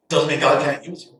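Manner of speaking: normal talking voice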